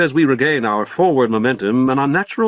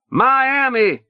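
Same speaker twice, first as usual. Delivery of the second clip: high, ringing announcement; announcing; 12 semitones higher than usual. A narrator's reading. Leo announces their arrival to the passengers, high ringing call. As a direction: call it out like a conductor. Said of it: "Leo announces".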